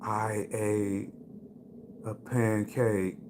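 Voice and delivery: explaining the pancake to his buddy; dazed stoner. Retold or imitated. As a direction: drag the words out slowly. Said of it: "They're like".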